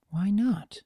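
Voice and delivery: unvoiced, breathy hush; whispered. A narrator's reading. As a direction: whisper it to herself. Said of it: "echoed Fluttershy's unvoiced question".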